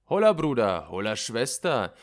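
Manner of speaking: laut und hoch hinausgerufen; lauter Ruf. Acted. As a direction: ruf die Pferde aus dem Stall. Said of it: "rief der Pferdeknecht". (der Knecht ruft laut die Pferde)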